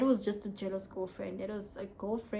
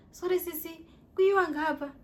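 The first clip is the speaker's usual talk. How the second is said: polite stranger; 7 semitones higher, 5 dB louder than usual. Retold or imitated. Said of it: "abantu who are like".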